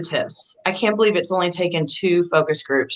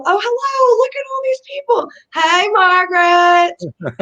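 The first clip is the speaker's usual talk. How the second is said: much higher, gushing hello to the crowd; delighted greeting; 12 semitones higher, 4 dB louder than usual. Real, not acted. Host greeting viewers arriving in the live chat, delighted. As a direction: greet each viewer like a happy surprise, stretching Margaret slowly.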